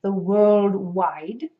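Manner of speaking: rounded lips, drawn-out British imitation; British accent. Shown as a demonstration. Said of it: "with a much more closed jaw".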